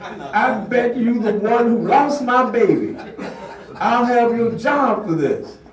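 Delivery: high, loud insulting tirade with laughter breaking in; screaming insults. Retold or imitated. Angry parent threatens the principal's job, screaming. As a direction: hurl the insults, half laughing at them. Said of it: "She was still screaming at the top of her voice".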